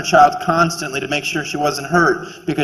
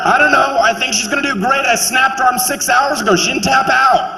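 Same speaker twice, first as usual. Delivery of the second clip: higher, hammed-up boasting parent voice; proud fight parent; 9 semitones higher than usual. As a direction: brag about your daughter like a crazed sports parent.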